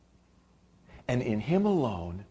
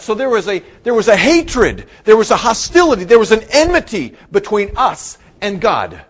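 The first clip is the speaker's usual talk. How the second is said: loud, high, building preacher's cry; forceful preaching; 8 semitones higher, 10 dB louder than usual. Real, not acted. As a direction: hammer each noun from the pulpit.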